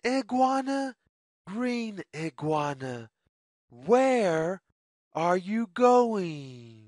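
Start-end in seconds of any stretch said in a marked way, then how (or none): none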